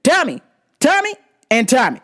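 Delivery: short high-pitched yelled name; mock yelling customer. Customer demanding his order by name, mock yelling. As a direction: yell it like an oddball customer. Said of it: "and it's loud".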